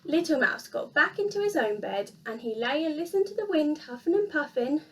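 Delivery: plain reading voice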